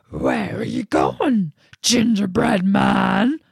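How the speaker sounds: slow, loud lumbering bear; big bear calling out slowly. Acted. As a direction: drag each word like a big bear.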